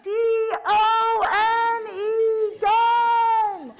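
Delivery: high loud spelled-out yell; screaming. Retold or imitated. Dangling on the rope, yelling down to her sister, high loud spelled scream. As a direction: scream each letter down the rock. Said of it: "'cause now I'm screaming".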